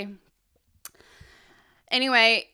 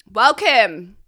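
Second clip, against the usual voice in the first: normal talking voice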